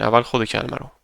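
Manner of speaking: ordinary delivery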